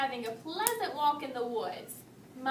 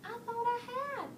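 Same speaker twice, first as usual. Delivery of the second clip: much higher small cub voice; Baby Bear voice; 10 semitones higher, 3 dB quieter than usual. A narrator's reading. Baby Bear answering his frowning father, higher and small. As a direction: answer like a timid little bear. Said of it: "answered Baby Bear".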